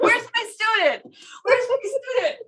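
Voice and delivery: high eager repeated call, laughing. Retold or imitated. Urologist calling for his student in the OR, high and eager. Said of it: "he was like".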